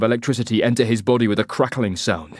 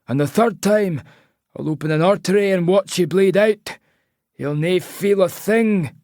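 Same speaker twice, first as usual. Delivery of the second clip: put-on threatening Scottish accent; 9 semitones higher than usual. A narrator's reading. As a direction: warn him coldly in Scottish. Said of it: "he says in a Scottish accent".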